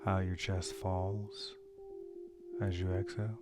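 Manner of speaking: calm, measured meditation guide with long pauses; unhurried breathing guidance, leaving space after each phrase; guided meditation. Real, not acted. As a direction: lead listeners gently through a breathing meditation.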